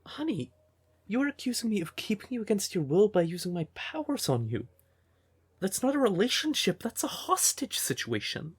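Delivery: high-pitched, incredulous protest; indignant. Acted. Protesting being accused of using powers on partner, indignant. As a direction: object in disbelief, pitch riding high.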